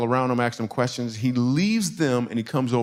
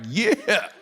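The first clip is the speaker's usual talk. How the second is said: cocky yeah; 7 semitones higher than usual. Retold or imitated. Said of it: "I'm the man".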